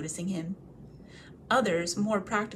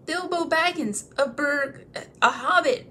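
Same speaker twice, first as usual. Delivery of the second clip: trembling hobbit; 7 semitones higher, 5 dB louder than usual. Acted. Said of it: "shaking all over".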